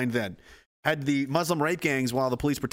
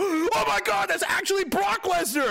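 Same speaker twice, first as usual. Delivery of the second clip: much higher shocked voice; shocked gasp. Retold or imitated. Activists realizing the truth, shocked and high. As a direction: gasp in disbelief. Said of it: "they go".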